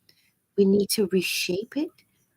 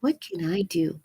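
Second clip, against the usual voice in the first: ordinary talk, nothing marked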